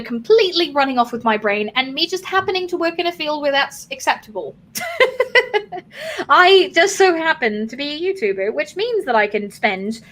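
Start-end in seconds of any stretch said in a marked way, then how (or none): 4.7-6.2 quick high amused laugh. Real, not acted. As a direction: let a light high laugh out. Laughs at own remark.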